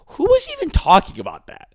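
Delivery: a sudden high squeak of disbelief; incredulous. Real, not acted. Ranting to his co-host in high-pitched disbelief. Incredulous rant about fighter.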